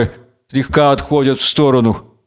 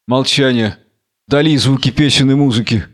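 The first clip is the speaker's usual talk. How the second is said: ordinary delivery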